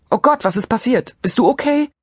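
übertrieben besorgt, deutlich höher und lauter; gespielt aufgeregt. A narrator's reading. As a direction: spiel die Aufregung dick auf. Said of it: "frage ich so aufgeregt wie möglich". (Erzählerin spielt bei der Freundin Drama mit, übertrieben aufgeregt)

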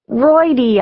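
a mock holler from under the covers. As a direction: call out to him across the room. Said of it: "I hollered from under the covers".

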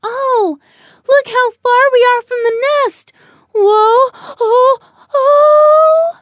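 high, loud wail as balance goes; alarmed young bird. Acted. Buddy losing his balance on the limb, alarmed. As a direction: cry out in rising panic, ending on a long wobbly oh. Little bird wobbling far from nest.

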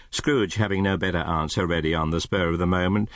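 ordinary delivery

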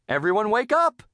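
calling out. A narrator's reading. Missus Puff to her sleeping class, calling out. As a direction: call out to rouse a sleeping room. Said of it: "Missus Puff called".

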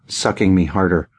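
ordinary delivery

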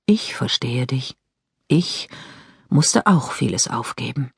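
tender ghost brother; gentle. Acted. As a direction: comfort her quietly.

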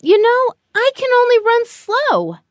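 very high little turtle voice; turtle character voice. A narrator's reading. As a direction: pitch it way up as a timid-sized creature. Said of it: "Tilly said".

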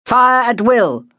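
high, slow shouted command; shouted order. A narrator's reading. Officer shouting to gunners to fire. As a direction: bellow the order across the trench. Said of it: "and then shouted".

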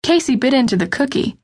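plain reading voice

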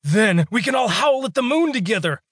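faked madness taunting a wolf guard; manic raving. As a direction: sell the madness, unhinged and gleeful. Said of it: "John exclaimed, twitching and squirming".

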